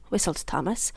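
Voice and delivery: ordinary delivery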